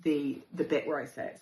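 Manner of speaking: ordinary talk, nothing marked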